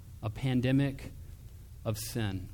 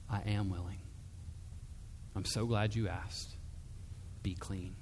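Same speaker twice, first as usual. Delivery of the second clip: softer, lower, ending in a whisper; quiet loving reply fading to a whisper; hushed tender answer; 5 semitones lower, 9 dB quieter than usual. Acted. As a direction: breathe the healing words gently.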